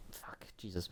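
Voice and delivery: breathless whispered curse after a real jump scare; startled whisper. Real, not acted. Jumping at a scare in the game, a startled whispered curse. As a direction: hiss it under your breath, shaken. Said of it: "my chest was a little tight there".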